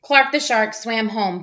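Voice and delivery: plain reading voice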